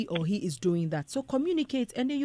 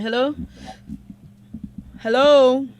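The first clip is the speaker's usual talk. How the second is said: louder calling hello; 4 semitones higher, 8 dB louder than usual. Real, not acted. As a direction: call out over a bad line. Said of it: "your voice is very low".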